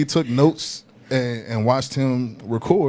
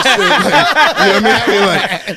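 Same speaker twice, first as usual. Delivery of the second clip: laughing; 9 semitones higher, 11 dB louder, slower than usual. Real, not acted. Laughing after cameraman story.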